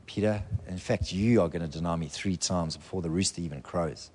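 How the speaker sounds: soft and low, like quiet foreknowledge; gentle warning. Acted. Jesus quietly warning Peter of the denial, soft and low. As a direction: tell him softly, without blame.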